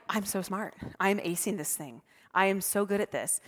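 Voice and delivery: soft smug self-talk; smug inner voice. Retold or imitated. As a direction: quietly gloat to yourself. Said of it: "I'm like".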